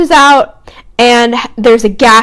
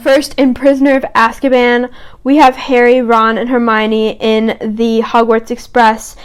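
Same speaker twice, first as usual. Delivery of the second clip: normal talking voice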